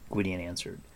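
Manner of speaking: ordinary delivery, nothing marked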